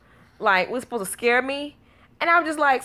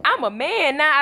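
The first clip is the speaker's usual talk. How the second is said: put-on higher kid voice, proudly declaring; imitated son; 6 semitones higher than usual. Son declares manhood to mama, proud. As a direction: announce it like a boy feeling grown. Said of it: "He say".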